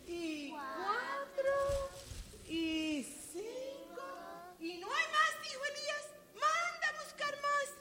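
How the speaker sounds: casi susurrando, mucho más suave y aguda; suspenso en voz baja. Acted. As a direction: baja la voz para crear misterio. Suspenso al contar vasos. (maestra cuenta vasos con los niños, en voz baja y misteriosa)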